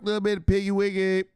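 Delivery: high, loud playful hype; high, loud hyped piggy wiggy; hyped repeat. Real, not acted. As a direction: sing it out like a hype man.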